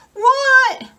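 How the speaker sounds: sudden high yelp of surprise; what, shocked by a comment. Real, not acted. Reading the live chat, a high shocked yelp.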